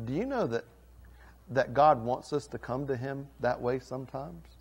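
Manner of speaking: ordinary talk, nothing marked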